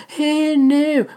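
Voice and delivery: high playful hey no; catchphrase squeal. Acted. Repeating his catchphrase to fans, high squeal. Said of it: "So I'm sticking to that".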